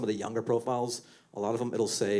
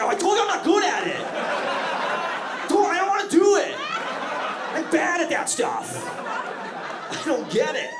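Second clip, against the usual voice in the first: much higher and louder, whining; whiny excuses; 4 dB louder than usual. Retold or imitated. Boyfriend whines to his girlfriend he can't do daddy talk, much higher and louder. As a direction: plead that you are bad at it. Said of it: "I was like".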